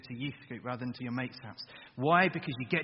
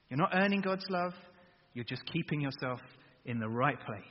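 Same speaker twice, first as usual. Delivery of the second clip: ordinary delivery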